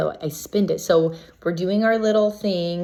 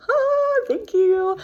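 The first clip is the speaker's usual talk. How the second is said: very high, airy sing-out of thanks; grateful relief; much slower than usual. Acted. Contestant thanking the universe for luck, high-pitched relief. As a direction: float it up high to the heavens. Mock-angelic thanks for a lucky break.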